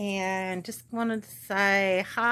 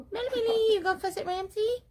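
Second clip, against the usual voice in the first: pet talk; faster than usual. Real, not acted. Owner asks Melody about fussing, high pet-talk voice. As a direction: tease a pet in a high squeaky coo.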